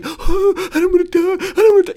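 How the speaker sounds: high, loud whiny wail; mock whiny coward. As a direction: whine like a scared coward. Mocking scared people.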